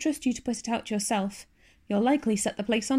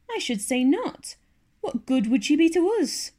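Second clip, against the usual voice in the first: scornful; 6 semitones higher, 4 dB louder than usual. A narrator's reading. Marilla scornful.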